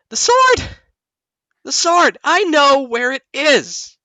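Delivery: Lulu to the party on waking, high excited shouting; high, loud cry of sudden excited discovery. Acted. Lulu hops back from the spyglass, bursting with excitement. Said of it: "face all excited. She'll be like".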